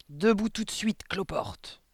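high, loud opening, dropping on the insult; stern, scornful headmistress; mildly barked order. A narrator's reading. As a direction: snap an impatient command. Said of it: "aboya-t-elle".

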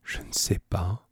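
very soft, hesitant murmur. A narrator's reading. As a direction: murmur it, barely audible. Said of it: "puis murmura". (murmure hésitant, très bas)

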